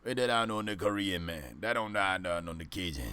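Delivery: a light Cajun accent, imitating Coach O. Coach at a press conference, light Cajun accent. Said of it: "He's got a heavy Cajun accent".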